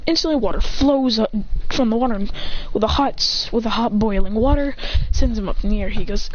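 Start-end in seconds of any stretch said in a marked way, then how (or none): none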